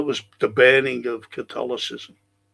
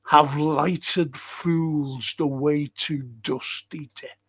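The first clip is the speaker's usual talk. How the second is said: very slow, weighty Shakespeare line; slow recitation; much slower than usual. Acted. Teacher re-reading the quote slowly to the class. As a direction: stretch every word out. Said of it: "All our yesterdays".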